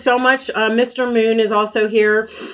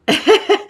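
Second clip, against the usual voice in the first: short laugh; 12 semitones higher, 3 dB louder than usual. Real, not acted. Laughing about choking on stream.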